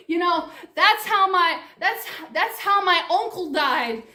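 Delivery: ordinary talk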